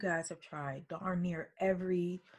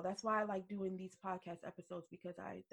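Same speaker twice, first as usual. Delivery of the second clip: ordinary delivery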